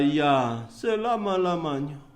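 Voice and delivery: low, soft, final verdict; grave Abraham. Acted. Abraham tells the rich man all hope is gone, low and grave. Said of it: "Bapak Abraham berkata".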